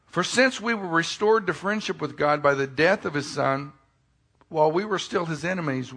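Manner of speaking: scripture reading. Acted. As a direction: proclaim the verses to the church. Reading scripture aloud, raised.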